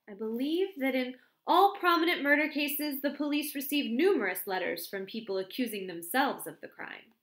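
ordinary delivery

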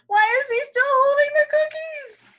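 anxious high outburst; alarmed shout. Acted. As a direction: cry out in alarm.

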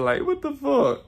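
high, exasperated what the fuck; mock indignant. Vlogger retelling his reply to critics, high exasperated. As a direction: throw up your hands, high. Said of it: "And I'm like".

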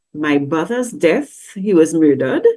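ordinary talk, nothing marked

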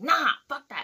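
high, loud, defiant burst; retold angry refusal. Driver rejects coworker's plea, angry defiant refusal. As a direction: re-enact brushing someone off angrily. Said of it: "I was flipping out".

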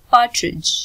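पार्ट्रिज said with no D sound before the final j; silent D. Shown as a demonstration. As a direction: say पार्ट्रिज as "par-trij", the D silent. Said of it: "डी silent होगा".

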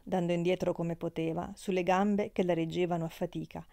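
ordinary delivery

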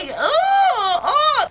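high, drawn-out "oh" of revulsion; disgusted squeal. Real, not acted. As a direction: recoil with a high groan. Recoiling from the pimple.

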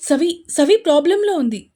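frightened cousin telling a friend of trouble; panicked. Acted. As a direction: blurt out the bad news. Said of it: "కంగారుగా చెప్పాడు".

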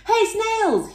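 high, loud storybook call; bright-pitched acted shout for attention. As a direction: hail your friends from a distance. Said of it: "he called".

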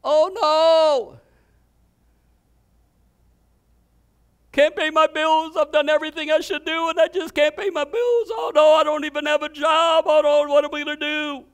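high, loud hammed-up whining about money; mock worry. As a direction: moan like a broke believer. Imitates worried believer about bills.

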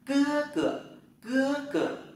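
cưa cửa said very high, cưa falling hard; Chinese tone drill. Shown as a demonstration. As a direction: demo cưa cửa with a sharp falling first syllable. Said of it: "Rồi, anh trai".

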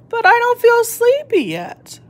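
little tortoise voice. Acted. Shelley tells her parents she is not sleepy, in a high child voice. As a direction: squeak it out like a wide-awake little one.

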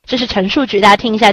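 normal talking voice

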